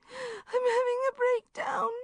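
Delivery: high, slow, tearful wail; sobbing. Acted. Distraught friend on the phone, sobbing high and slow. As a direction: weep it out in a high voice. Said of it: "she sobbed".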